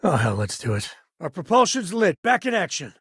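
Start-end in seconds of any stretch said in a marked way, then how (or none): 1.2-3.0 high, eager cheer; pumped the ship is flying again; excited. Real, not acted. Cheering to the captain as the ship runs again, excited.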